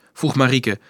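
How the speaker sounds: ordinary delivery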